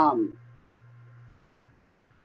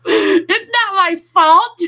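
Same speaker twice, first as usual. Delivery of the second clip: high, laughing "not my fault"; laughing protest; 13 semitones higher, 4 dB louder, slower than usual. Real, not acted. Streamer laughs back at a joking viewer, high and giggly. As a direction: giggle through a mock defence.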